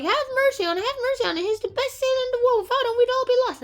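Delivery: high, hurried begging voice; mock pleading. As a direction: plead in a high, hammed-up rush. Crowd begs for mercy.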